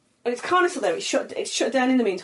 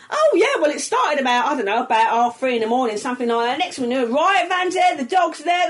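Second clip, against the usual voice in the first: higher, louder, laughing chatty cashier; gossiping till woman; 5 semitones higher, 4 dB louder than usual. Retold or imitated. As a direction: relish telling the juicy gossip. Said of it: "She went".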